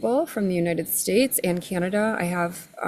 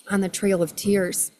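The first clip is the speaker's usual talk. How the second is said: ordinary delivery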